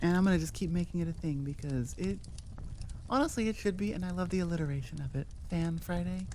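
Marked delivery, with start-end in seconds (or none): none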